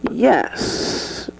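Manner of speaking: normal talking voice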